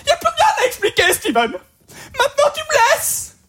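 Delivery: very high shrieking mock soap line; hysterical dubbed girlfriend. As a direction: scream it like an overacted dubbing. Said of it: "La fille pleure".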